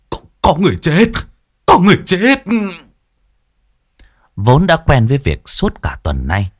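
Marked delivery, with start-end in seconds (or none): 0.1-1.1 stuttered, higher and quick terrified cry; stammering panic. A narrator's reading. Old Đinh telling villagers of a death, stammering panic. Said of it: "mà mếu máo". 1.7-2.4 much higher and louder terrified yell; panicked scream. A narrator's reading. Old Đinh crying to the village about the corpse, panicked scream. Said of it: "mà mếu máo".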